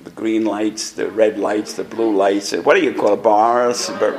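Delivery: usual speaking voice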